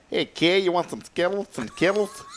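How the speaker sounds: put-on high, lisping Connecticut accent. Host offers candy to a kid, lisping Connecticut accent. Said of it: "The lisp comes out on that".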